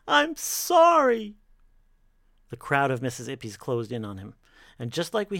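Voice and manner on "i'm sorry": slow, very high wailing plea; begging for forgiveness. Acted. Brooks pleads as his wife kicks him off, begging. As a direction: wail each phrase loudly, drawing it out. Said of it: "he begged for forgiveness".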